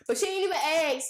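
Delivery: high, loud outrage; exasperated disbelief; indignant. Real, not acted. Outraged retelling of mom.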